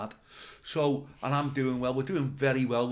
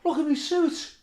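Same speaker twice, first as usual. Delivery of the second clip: higher, hammed-up moan about the suit; mock outrage; 10 semitones higher than usual. Comic retelling his ruined suit, hammed-up high whine. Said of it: "I went".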